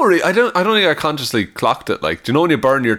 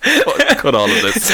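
laughing plea; 8 semitones higher, slower than usual. Real, not acted. Laughing plea to cut tape.

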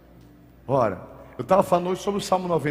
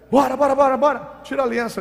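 high, loud robber's order; armed robber; 8 semitones higher, 5 dB louder than usual. Retold or imitated. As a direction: press the victim for the ring. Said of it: "e aí". (assaltante exigindo a aliança, agudo)

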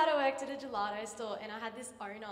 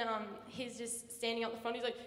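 ordinary talk, nothing marked

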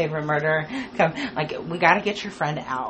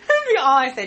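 laughing; 6 semitones higher, 4 dB louder, much faster than usual. Shown as a demonstration. Repeating the punchline.

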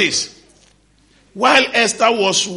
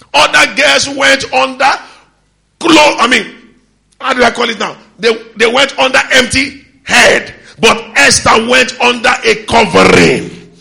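preaching at full force; 5 semitones higher, 9 dB louder than usual. Acted. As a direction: hammer the point to the congregation.

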